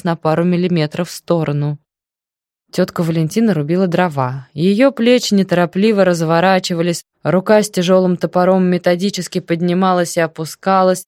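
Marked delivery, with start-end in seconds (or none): none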